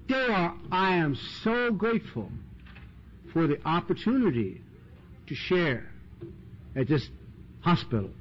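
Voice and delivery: a lightly mocked saintly AA member; pious grateful sharer.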